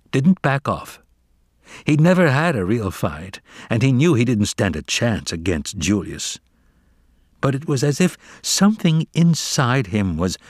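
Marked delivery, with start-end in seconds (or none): none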